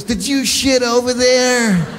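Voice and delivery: high, slow mock scolding of the dog; wife scolding dog. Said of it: "She goes".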